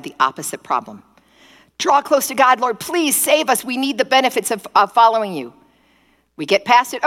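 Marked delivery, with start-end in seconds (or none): 1.8-5.5 higher-pitched, hurried-to-God begging; urgent mock plea. Israelites begging God to save them, urgent plea. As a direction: plead as if in a crisis. Voicing desperate Israelites in crisis.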